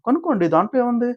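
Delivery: high-pitched mock-haughty retort; imitating an offended celebrity; acted affronted dismissal. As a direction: toss it back, pitch soaring. Acted offended reply about her name.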